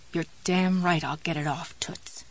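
hushed, grim inner voice; grim thought. A narrator's reading. Jessie vowing to get the cap off, grim. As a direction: think it with grim resolve, half-whispered. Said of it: "Jessie thought grimly".

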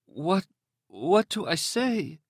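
high, slow, halting woman's plea; frightened captive. Acted. Captive asks razor-wielding man what to say, frightened. Terrified captive with swollen mouth.

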